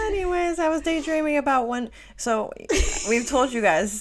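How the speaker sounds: high voice still laughing; pushing through giggles. Real, not acted. After a laughing fit.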